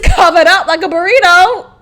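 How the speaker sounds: ordinary talk, nothing marked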